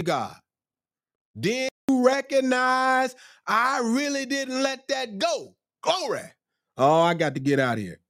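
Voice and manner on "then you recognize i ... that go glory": very high, slow preaching delivery; worked-up preaching. Real, not acted. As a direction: stretch it out high, exhorting the congregation. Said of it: "I'm getting worked up now".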